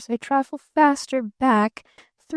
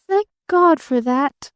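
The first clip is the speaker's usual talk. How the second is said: louder, slow, gasping relief; relieved gasp; 3 semitones higher, 6 dB louder than usual. A narrator's reading. Girl relieved Barton won't marry her, a loud gasping thank God. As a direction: breathe out your relief loudly. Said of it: "gasped little Eve Edgerton".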